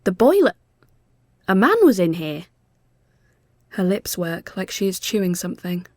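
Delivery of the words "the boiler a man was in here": shocked. A narrator's reading. Mel horrified.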